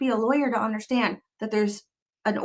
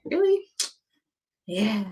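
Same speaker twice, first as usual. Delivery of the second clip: mock shock, pitched way up; sarcastic disbelief. Real, not acted. Sarcastic reaction.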